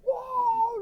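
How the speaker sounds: very high, drawn-out cry of wonder; mock amazed whoa. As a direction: let the dad gasp out his wonder. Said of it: "And like".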